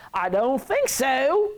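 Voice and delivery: exaggerated drawn-out refusal; expressive refusal. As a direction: draw it out as a sassy no way. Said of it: "words are used expressively".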